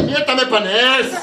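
slow, loud brash comeback; brash retort. Retold or imitated. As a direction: snap back at the usher. Woman answering usher.

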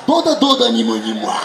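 אמא רוסייה כועסת. Acted. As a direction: bark gibberish like an angry Russian mum. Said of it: "אבל יש שם כעס". (אמא רוסייה נוזפת בקול רם)

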